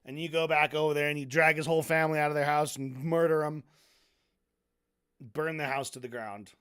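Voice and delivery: normal talking voice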